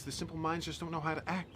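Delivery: ordinary talk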